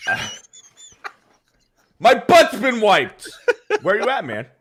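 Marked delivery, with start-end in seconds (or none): 2.0-4.5 mock yell. Co-host cracking up at the super chat, hammed-up loud shout. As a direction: shout it out like a cartoon demand.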